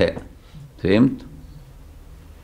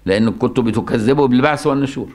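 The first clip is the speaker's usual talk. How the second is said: ordinary talk, nothing marked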